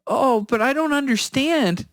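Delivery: imitating a bewildered old lady; little old lady. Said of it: "changes his voice to a little old lady's voice".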